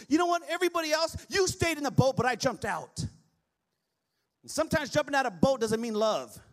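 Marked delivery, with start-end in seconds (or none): 0.1-3.0 higher, loud bragging shout; cocky Simon crowing; boastful shout. Retold or imitated. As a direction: brag over everyone else. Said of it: "Simon had the same attitude".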